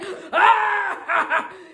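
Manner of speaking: loud high cackling laugh; manic laugh. Retold or imitated. Old man on airport shuttle mocking her jacket, manic laugh. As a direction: cackle like a delighted maniac. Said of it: "It was like a manic laugh".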